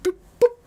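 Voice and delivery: squeaky high boop-boop, loud; cartoon flick sound effect; playful boop. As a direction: make a silly flicking noise, way up high. God flicking Assyria away.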